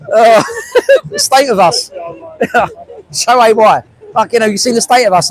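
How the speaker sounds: real laughter breaking through the words; laughing at himself. Real, not acted. Streamer laughing at the crew's footage while talking.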